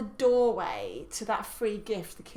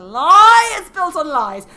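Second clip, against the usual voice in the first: loud; 7 semitones higher, 19 dB louder, much slower than usual. Real, not acted. Mock outburst against Santa.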